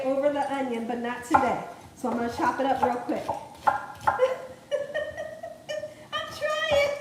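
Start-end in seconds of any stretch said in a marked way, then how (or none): 3.8-5.9 high giggle. Real, not acted. Laughing at onion struggle. 6.4-6.9 strained laugh. Real, not acted. Struggling with onion.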